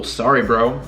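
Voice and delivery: normal talking voice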